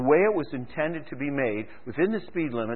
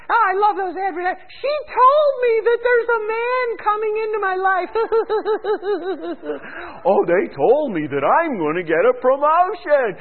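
much higher, breathless gushing falsetto; mock giddy caller; 4 dB louder than usual. Preacher mocks psychic-line callers in a much higher gushing falsetto. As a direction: gush like a gullible fan of the ads.

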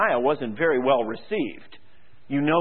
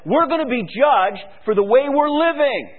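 Jeremiah warning; 10 semitones higher, 5 dB louder than usual. Retold or imitated. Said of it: "he said".

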